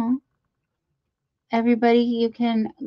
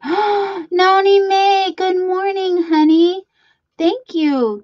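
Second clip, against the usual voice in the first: much higher, slow, sing-song hello; light up and stretch the name out; delighted greeting; 9 semitones higher, 6 dB louder, slower than usual. Real, not acted. Host greeting new member on stream, slow and delighted.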